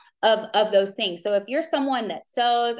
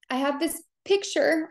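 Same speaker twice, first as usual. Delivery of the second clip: fighting back tears on the first words; real crying; 6 semitones higher than usual. Real, not acted. Coach tearing up as she shows followers her photo. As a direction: voice lifted high and tearful as she starts to cry. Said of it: "Oh God, here I am crying".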